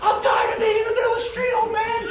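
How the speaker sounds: put-on shouting at the old man from the street; exasperated shout. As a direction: yell it over the traffic, fed up. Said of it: "and I'm like".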